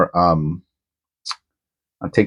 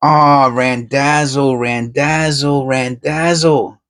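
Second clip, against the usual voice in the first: higher, slow, stretched name; excited drawn-out calling; 7 semitones higher, much slower than usual. Real, not acted. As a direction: sing out his nickname in delight. Calling out the friend's nickname.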